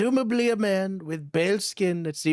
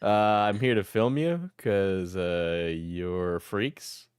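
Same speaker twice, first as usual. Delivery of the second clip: lower Diesel voice; 11 semitones lower, slower than usual. Acted. Diesel answers captor.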